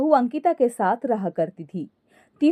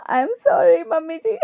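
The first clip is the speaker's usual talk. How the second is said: high hammed-up cackles around higher-pitched words; laughing apology; 11 semitones higher than usual. Daughter-in-law saying sorry to mother-in-law, laughing uncontrollably. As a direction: apologise while failing to stop laughing. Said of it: "बहू हंसते हुए ही बोली".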